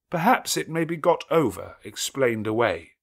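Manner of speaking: the priest refusing, unsettled; hesitant clergyman. A narrator's reading. Clergyman voiced.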